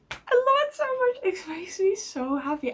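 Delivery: real giddy delight; gushing. Real, not acted. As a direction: spill it out giddy and fast. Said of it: "The reason I'm freaking out".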